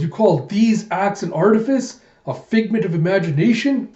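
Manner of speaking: high, loud, rising challenge; indignant rage. Acted. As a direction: roar the questions back at the accuser. Den rages back at Chaos.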